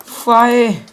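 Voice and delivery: high, loud curse; raging gamer. Real, not acted. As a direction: bark the word in frustration. Cursing after a failure.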